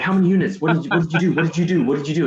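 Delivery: loud, high, pushy demand in his face; imitating a sales manager barking questions; mock yelling manager. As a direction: fire the questions at him, getting in his face.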